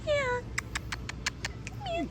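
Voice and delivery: high, sweet pet voice. Real, not acted. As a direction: coo at the timid dog. Talking to a shy dog.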